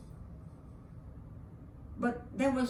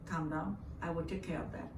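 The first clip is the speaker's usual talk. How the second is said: soothing; 3 semitones lower, 5 dB quieter, faster than usual. Retold or imitated. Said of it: "she told me to calm down".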